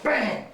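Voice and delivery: sudden high bang; sharp vocal impact; imitated strike. Shown as a demonstration. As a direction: punch the word out like a blow landing.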